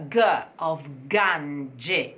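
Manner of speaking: g of Gange with a clear hard g, slowly; hard g demo. Shown as a demonstration. Coach showing the hard g of Gange, slow. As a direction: lean on the hard g. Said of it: "and then you have g".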